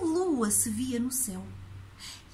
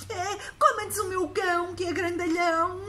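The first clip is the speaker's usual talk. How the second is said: put-on scared little boy; 6 semitones higher than usual. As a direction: beg like a frightened child. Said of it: "disse ele sem pensar".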